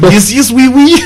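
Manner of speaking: high, silly French caricature; mock French accent. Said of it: "Let's hear French".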